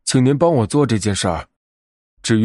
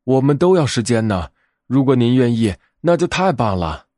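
usual speaking voice

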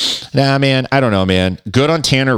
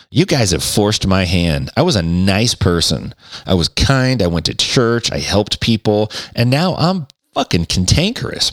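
ordinary talk, nothing marked

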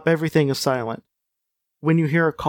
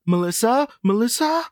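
high questioning call, rising sharply at the end; Marty McFly's daughter; 8 semitones higher than usual. Acted. Voice from upstairs calls for Melissa, high questioning call. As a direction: call the name upward, unsure who's there. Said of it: "you sounded like".

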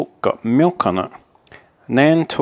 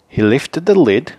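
ordinary delivery, nothing marked

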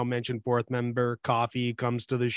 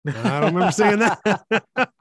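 slow, higher, louder, laughing; 6 semitones higher, 5 dB louder, slower than usual. Retold or imitated. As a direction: laugh through the disbelief. Same quote repeated with laughter.

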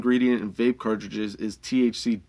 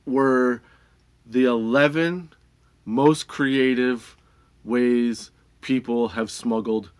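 slow dramatic; much slower than usual. Real, not acted. Dramatic build-up reveal.

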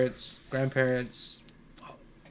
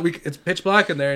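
normal talking voice

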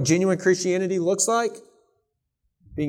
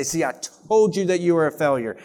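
ordinary talk, nothing marked

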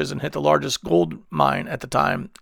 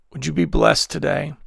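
ordinary delivery

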